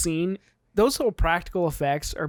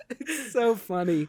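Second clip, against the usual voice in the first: laughing through the words, slowed; 4 semitones higher, slower than usual. Real, not acted. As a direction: let the laugh carry it. Laughing at the scene.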